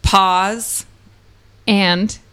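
one word at a time, paused; staccato. Acted. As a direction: drop each word alone, with gaps. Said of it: "One, one word at a time?".

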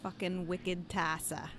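put-on Boston accent. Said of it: "that's pretty good, actually".

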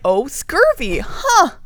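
high, loud mock discovery; surprised realization. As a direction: play the doctor finally getting it. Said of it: "or like".

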